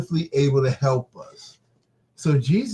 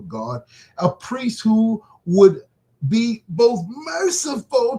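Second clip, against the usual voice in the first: raised, drawn-out lofty reading; arrogant scripture reading; 6 semitones higher than usual. Real, not acted. Preacher reading of the merciful high priest, arrogantly. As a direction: read scripture high and emphatic, stretching the phrases. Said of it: "Why you gotta be so arrogant? Why you gotta talk like that".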